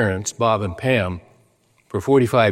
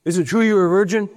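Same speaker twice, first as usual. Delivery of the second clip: higher, half-shouted heckle, fast at the end; mock yelled question; 8 semitones higher, faster than usual. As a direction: call it out like a cheeky reporter, not in full. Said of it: "one guy yells at him".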